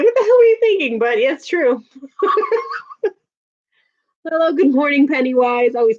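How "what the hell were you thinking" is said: high, loud mock disbelief at her younger self; high mock outrage at her old habits; incredulous at herself. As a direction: scold your past self in disbelief. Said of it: "I look back at it now like".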